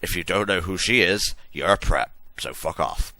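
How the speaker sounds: usual speaking voice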